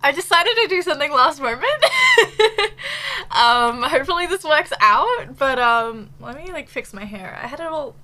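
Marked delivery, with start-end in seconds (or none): 1.7-3.2 a burst of high, loud laughter. Real, not acted. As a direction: laugh it off, nervous and bright. Laughs at last-moment stream idea.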